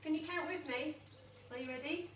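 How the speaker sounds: ordinary delivery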